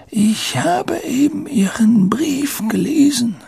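put-on very high, feeble old voice; frail old headmaster. (hohe, schwache Greisenstimme)

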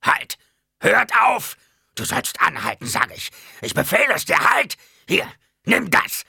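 loud, high, furious shouted commands; raging old Baron. A narrator's reading. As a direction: rage and roar orders as a tyrant losing control. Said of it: "Die Stimme des alten Barons tobte". (tobend, wütend schreiend)

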